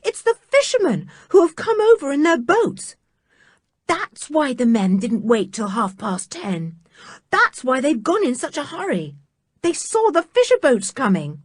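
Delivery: high-pitched eager rush; excited realisation. Acted. George to the others, a high excited rush. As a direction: tumble out the explanation.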